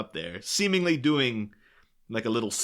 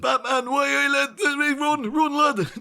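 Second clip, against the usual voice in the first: high, loud mock Welsh veering into Geordie; botched Welsh accent; 14 semitones higher, 5 dB louder than usual. Co-host voices a Welsh gang member spotting Batman, botched Welsh accent. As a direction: attempt a Welsh lilt and slip into loud Geordie. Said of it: "That was more Geordie".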